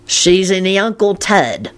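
slow, mocking Cockney drawl. A narrator's reading. Lupin mimicking Mundungus, mocking Cockney. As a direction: parody his Cockney rhyming slang. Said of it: "in a mocking Cockney voice".

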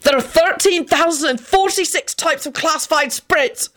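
high-pitched frantic yelling; wild yell. A narrator's reading. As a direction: yell the answer wildly, way up high. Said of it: "Benji yelled wildly".

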